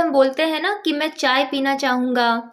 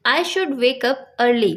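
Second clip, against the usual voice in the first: very slow, carefully spaced English words; slow teaching demo; much slower than usual. Shown as a demonstration. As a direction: dictate it word by word to a learner. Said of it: "example देखिए".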